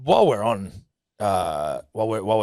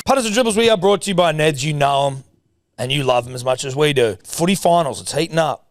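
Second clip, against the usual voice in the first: loud, high sponsor read; punchy betting ad voice; 6 semitones higher, 6 dB louder than usual. Acted. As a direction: sell it like a radio ad.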